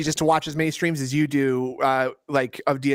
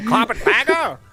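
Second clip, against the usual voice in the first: old-timey cartoon voice; 6 semitones higher, slower than usual. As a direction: bark it like an old cartoon villain. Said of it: "doing the old-timey voice".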